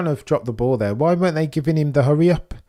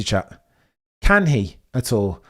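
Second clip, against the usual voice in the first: ordinary delivery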